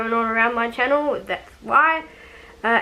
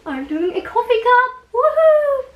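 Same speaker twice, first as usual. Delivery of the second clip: much higher and louder, an excited woohoo; excited cheer; 13 semitones higher, 5 dB louder than usual. Real, not acted. Showing off her coffee cup carving to viewers, excited.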